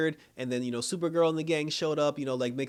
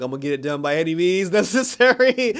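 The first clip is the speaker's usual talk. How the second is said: loud determined vow; 4 semitones higher, 11 dB louder, faster than usual. Retold or imitated. Supergirl vows forcefully.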